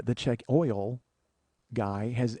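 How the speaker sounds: ordinary talk, nothing marked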